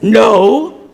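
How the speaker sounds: loud, punchy, indignant; emphatic no. Retold or imitated. Wife refusing to hear the dress is tight, loud indignant no. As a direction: slam the denial down.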